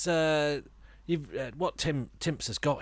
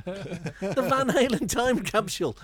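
announcer voice; 8 semitones higher, 5 dB louder, slower than usual. Acted. Grand title announcement.

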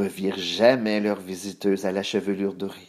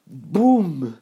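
loud, higher, drawn-out "boum"; crash sound effect; 6 semitones higher, 5 dB louder than usual. A narrator's reading. Narrator imitating the chair collapsing, loud boom.

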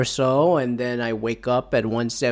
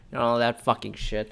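normal talking voice

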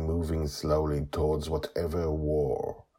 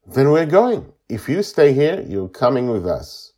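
raised, loud voice; firm ultimatum; 9 semitones higher, 11 dB louder than usual. A narrator's reading. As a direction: lay down the law to a stubborn child. Joy gives child an ultimatum.

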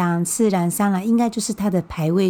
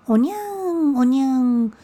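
high imitated newborn wail; baby crying; 6 semitones higher than usual. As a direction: mimic a baby's cry, higher pitched. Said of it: "听到这个". (模仿婴儿哭声，声音拔高)

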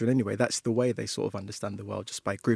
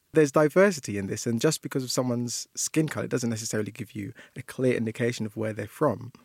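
normal talking voice

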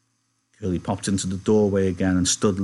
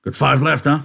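high, loud ranch-hand voice; loud, eager big man; 6 semitones higher, 6 dB louder than usual. Acted. Big man asking Slim about the pups, loud and curious. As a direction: ask it loudly, pitched up.

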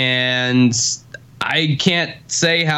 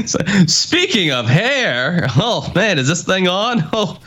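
higher, hammed-up corny stand-up voice; mock cheesy comic; 5 semitones higher than usual. Mocking a co-host's segue, cheesy comic. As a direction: imitate a hack comedian working the room. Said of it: "that's the cheesiest".

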